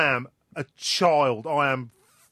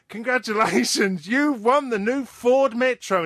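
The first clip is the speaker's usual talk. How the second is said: slow, high, louder prize-caller voice; prize announcer; 9 semitones higher, 6 dB louder, slower than usual. Retold or imitated. Boy prank-calling a stranger, cheesy prize announcer. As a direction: hype it up like a radio host. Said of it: "And I go to this guy".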